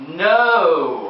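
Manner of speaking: firm, drawn-out no at full voice; firmer no. Acted. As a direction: put your foot down, still controlled. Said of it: "a little more firmly".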